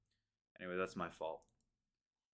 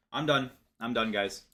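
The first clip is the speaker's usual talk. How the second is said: loud, exasperated outburst; fed up; 3 semitones higher, 10 dB louder than usual. Real, not acted. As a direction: burst out loudly, really fed up. Fed-up trader quitting the stream.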